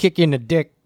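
imitated Chicago accent. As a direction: chime in with a mock Chicago accent. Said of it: "thickest Chicago accent you've ever heard".

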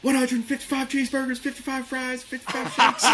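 very high, strained comic ordering voice; exaggerated order. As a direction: belt out a huge order way up high. Big order acted in retelling.

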